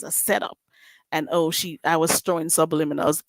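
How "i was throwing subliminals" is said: ordinary delivery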